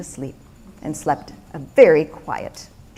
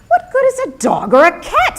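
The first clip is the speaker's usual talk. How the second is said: incredulous Peter; 11 semitones higher than usual. Acted. Peter questions the wise man.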